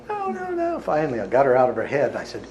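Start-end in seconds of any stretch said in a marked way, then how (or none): 0.1-0.8 much higher, squeaky frightened refusal; panicked woman. Retold or imitated. Healer voicing frightened client begging him to stop, high panicked squeak. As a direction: pitch way up like a scared woman pleading.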